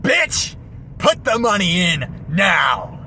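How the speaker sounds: high shouted robber demand; bank robber. Pretend. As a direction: bark it high and slow. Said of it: "I could try something like that".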